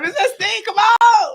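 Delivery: hollering for her friend at the festival; excitedly shouting a friend's name over the crowd; calling out. Retold or imitated.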